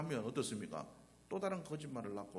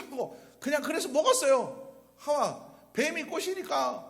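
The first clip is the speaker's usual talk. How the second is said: higher and louder, a sinner's confession; pleading Adam; 9 semitones higher, 8 dB louder than usual. Pretend. Preacher voicing Adam confessing to God, high loud pleading. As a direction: beg God like a caught child making excuses. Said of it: "아담과 또 그렇게 했으면 얼마나 좋겠습니까?".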